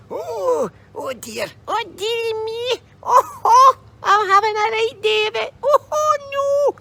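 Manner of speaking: put-on very high, whimpering old crone; wailing old lady.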